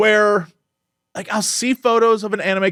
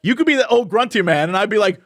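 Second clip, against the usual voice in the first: ordinary delivery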